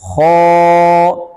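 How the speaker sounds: खौ recited heavy and full from the throat, loud; heavy recitation. Shown as a demonstration. Teacher showing students the heavy letter, loud recitation. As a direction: make खौ thick and drawn out. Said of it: "इसको मोटा ज़रूर करना है".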